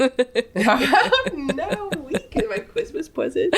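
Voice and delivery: high, giggly flirty voice; laughing mock voice. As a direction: laugh through a silly come-on. Mocking the kiss line, laughing.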